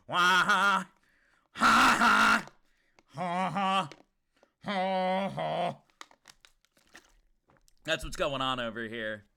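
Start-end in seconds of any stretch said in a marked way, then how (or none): 0.1-5.8 loud sung ha-ha riff fading out; vocal guitar riff. Pretend. Streamer mimics the riff, dying away. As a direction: mouth the guitar part, trailing off.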